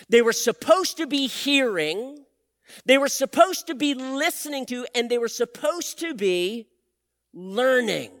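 rising pulpit fervour; building sermon. Acted. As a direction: build it up to "learning".

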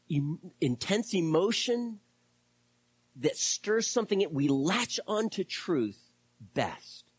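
ordinary delivery

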